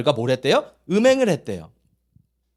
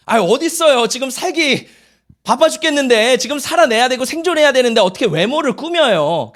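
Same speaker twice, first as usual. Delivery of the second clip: higher, mildly exasperated outburst; harried protest; 6 semitones higher, 4 dB louder than usual. Acted. Pastor recalling a three-day walk with no time for looks, harried protest. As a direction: plead that survival comes first.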